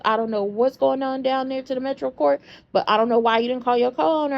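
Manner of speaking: ordinary talk, nothing marked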